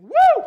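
a sudden high loud whoop; startling joyful whoop; retold whoop. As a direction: recreate the whoop that scared the cashier. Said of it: "I just kinda went out of it".

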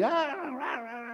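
high, softened mock roar; mock devil yell. Devil drowning out Jesus's voice, mock yelling. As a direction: imitate a yelling devil without full volume. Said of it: "the devil was yelling".

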